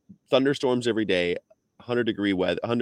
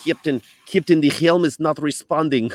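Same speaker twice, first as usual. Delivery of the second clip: loud, high mock Russian accent; Chekov; 8 semitones higher, 6 dB louder than usual. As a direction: imitate an alarmed Russian-accented starship officer. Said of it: "the accent that, that Chekhov had in Star Trek".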